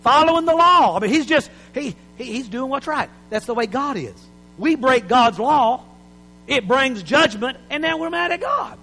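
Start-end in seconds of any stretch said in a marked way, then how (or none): none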